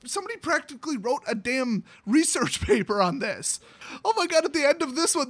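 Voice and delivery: ordinary delivery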